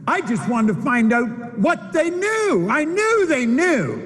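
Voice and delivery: slow, high, drawn-out oratory; impassioned preaching. Acted. Speaker telling audience why he took the job, impassioned preaching. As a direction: stretch the words out high with fervour. Motivational speaker at full force.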